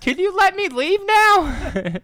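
loud, high, drawn-out mock plea; old lady pleading. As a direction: wail slowly in a hammed-up old-lady voice. Co-host plays the trapped old lady.

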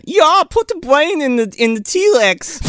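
high-pitched mock German accent; hammed-up German director impression; Uwe Boll. Director ordering a brain into the T-Rex, mock German accent. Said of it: "not even the real Uwe Boll".

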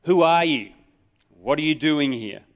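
barking soldier. A narrator's reading. Soldier barks at the trespassing rabbi, loud and sharp. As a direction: bark it at the intruder. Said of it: "shattered by the bark".

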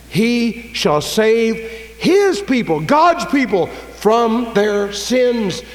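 loud, high, very slow proclamation; full-force preaching. Real, not acted. Preacher declaring God saves his people, full-force preaching. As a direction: ring out each phrase of the verse, long and high.

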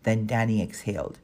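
plain reading voice

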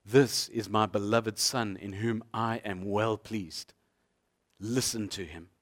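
a hint of a divine voice, hushed and slow; voice from heaven. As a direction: intone it gently like a voice from above. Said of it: "you hear the voice coming from heaven saying".

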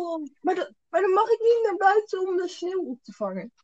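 playful whining complaint; mock whine. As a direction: whine it like a sulky kid. Mock whine about the curfew.

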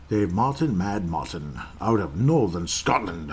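mock Scottish accent, drawn out at the end; semi-wrong Scottish accent. Host naming a listener from Northern Scotland, mock Scottish accent. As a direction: have a playful go at a Scottish accent, badly. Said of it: "wrong, semi-wrong accent".

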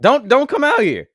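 high, loud mother's plea; pleading loudly like a worried mom; mom's warning. Retold or imitated. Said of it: "my mama was like".

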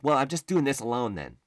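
high, loud snapping retort; wife snapping. Retold or imitated. Wife snaps back at him over renovation choices, fed up. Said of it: "before she snaps on me".

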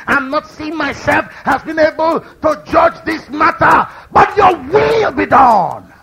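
slow, loud surrender cry; preaching at full force. Acted. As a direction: stretch out "your will be done".

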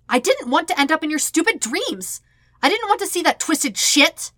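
indignant yelled snap; snapping back. Acted. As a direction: fire it straight back at him. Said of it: "Sokka snaps right back".

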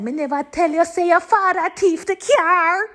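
high-pitched scolding mom in Jamaican Patois; Jamaican Patois mother. Retold or imitated. Imitating mother's patois outburst.

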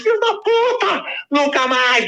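high, crying furious shriek; shrieking tearful rage. Retold or imitated. Furious mother shrieks at the neighbor, high tearful scream. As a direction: scream it through tears, out of control. Mother curses walking back inside.

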